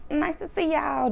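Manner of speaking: high, falsely pleased greeting; fake pleasantry. Wendy greeting women she dislikes, fake pleasantry. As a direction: imitate a phony nice hello. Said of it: "knowing she damn well not happy".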